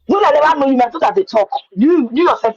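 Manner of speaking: ordinary talk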